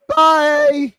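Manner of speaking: loud, high-pitched sung-out farewell; showy goodbye. Real, not acted. Host ending the stream, loud high goodbye.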